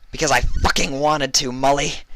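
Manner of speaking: high, loud, contemptuous; spat. A narrator's reading. Vinyl spits her answer at Mully, venomous. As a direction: spit the words at her. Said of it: "Vinyl spat".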